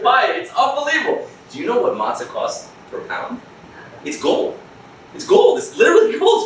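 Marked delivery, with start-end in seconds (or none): 5.1-6.4 much higher, louder exclamation; exclaimed. Real, not acted. Teacher marvelling at the price of matzah. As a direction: blurt it out amazed. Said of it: "exclaimed repeat".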